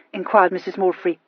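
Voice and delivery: ordinary delivery, nothing marked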